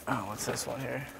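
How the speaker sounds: ordinary delivery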